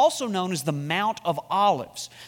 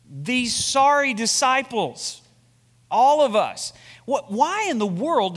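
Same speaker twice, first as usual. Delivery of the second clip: louder, higher for emphasis; raised preaching emphasis; emphatic; 6 semitones higher, 6 dB louder, slower than usual. Real, not acted. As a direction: lean in hard on it. Preacher stressing a point.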